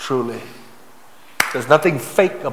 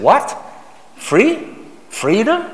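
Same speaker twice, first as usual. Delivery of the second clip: high-pitched mock disbelief; acted astonishment, high and questioning; incredulous questioning; 9 semitones higher, much slower than usual. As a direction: query each word as if baffled, pitch leaping up. Acting listeners' disbelief at Jesus.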